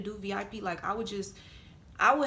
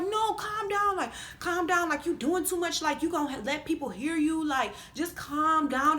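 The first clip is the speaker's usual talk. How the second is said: higher-pitched imitation of pleading; raised-pitch, insistent calming down; urgent placating; 6 semitones higher than usual. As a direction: mimic a man trying to hush someone. Man hushing her in acted quarrel.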